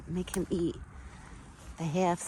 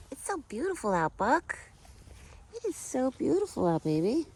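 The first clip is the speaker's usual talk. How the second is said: cooing to a pet; 8 semitones higher than usual. Real, not acted. Woman cooing to her pet Buck outside, baby talk.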